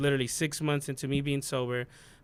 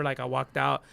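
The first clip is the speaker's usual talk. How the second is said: ordinary talk, nothing marked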